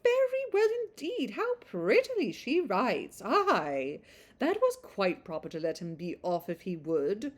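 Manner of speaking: put-on high, fluting old lady, delighted; cheerful old busybody praising the letter; Mrs Jennings gushing. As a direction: gush over the letter like a doting matron. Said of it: "who read it out loud with many comments".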